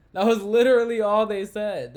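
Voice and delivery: loud and emphatic That was literally all they said; a sudden loud exasperated restatement; loud exasperated repeat. Real, not acted. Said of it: "And that was literally all they said".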